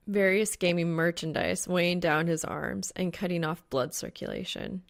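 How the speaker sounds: ordinary delivery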